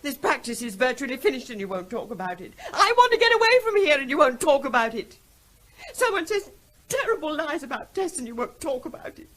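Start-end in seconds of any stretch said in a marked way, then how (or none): 2.7-9.3 rising bitter outburst. Acted. Wife's mounting outburst at husband.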